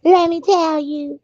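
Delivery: high, mocking sing-song imitation of a fawning woman; mocking Kimmy. Imitating Kimmy sucking up to the pastor, high and mocking. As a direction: ham up her sucking up to the pastor, pitched high. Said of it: "And you be like".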